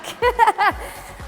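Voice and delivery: short high laugh while pedalling; breathless laugh. Real, not acted. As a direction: laugh mid sprint. Laugh after losing the mic.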